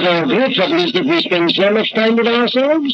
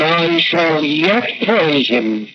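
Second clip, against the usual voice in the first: very slow, each phrase set apart; slow, grave psalm recitation; solemn scripture quote; slower than usual. Retold or imitated. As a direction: declare the psalm line by line. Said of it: "you say with this man".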